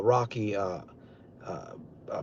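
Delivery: ordinary talk, nothing marked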